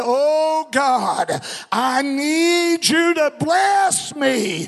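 high, stretched-out cry to God; crying out in prayer. Shown as a demonstration. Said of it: "Come on, I can't hear you".

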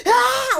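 high, loud mock scream; short shriek of a tantruming kid; imitating little brother's screaming fit; imitated scream. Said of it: "He starts screaming".

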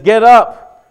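loud, high shout to wake a sleeper; retold yell. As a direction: yell it like you're rousing someone. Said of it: "He yells at him".

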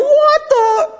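high, squeaky scandalized whisper-gasp; a stunned, high-pitched "what the?"; shocked disciples. Retold or imitated. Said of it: "They're back there going".

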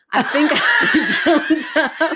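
slow, high giggling through words; laughing. Real, not acted. Laughing at her own dream story.

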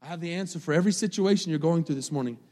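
ordinary talk, nothing marked

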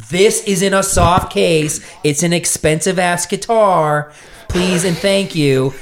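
drawn-out, high-pitched polite insistence; emphatic retold plea. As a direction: spell it out slowly for airline staff. Retold plea to airline staff.

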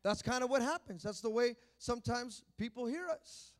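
ordinary talk, nothing marked

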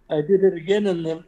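usual speaking voice

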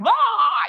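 loud, high "aah" of mock alarm; mock alarmed cry. Guest imitating her reaction to weird happenings, mock alarmed cry. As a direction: cry out as if life went haywire.

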